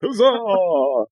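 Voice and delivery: high, drawn-out hurrah; mock cheer. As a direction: let a playful cheer ring out and fall. Cheering an inspiration point.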